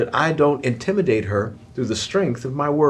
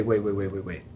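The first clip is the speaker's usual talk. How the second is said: softer, calm and measured; hushed check-in; 6 dB quieter than usual. Retold or imitated. Spouse halting a hurtful remark, softly. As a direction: gently pause the fight. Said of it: "whereby we say".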